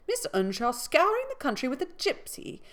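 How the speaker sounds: put-on high, loud fretting old dame; shocked old lady. A narrator's reading. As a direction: cry out in shock. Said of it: "cried the dame".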